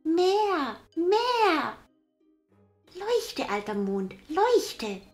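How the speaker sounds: put-on very high, loud child; bossy boy shouting orders; demanding little boy. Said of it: "schrie Häwelmann".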